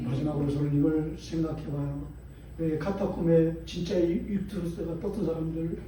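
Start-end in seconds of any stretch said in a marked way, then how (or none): none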